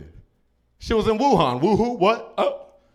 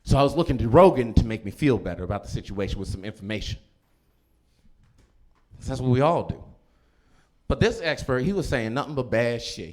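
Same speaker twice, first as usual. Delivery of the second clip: usual speaking voice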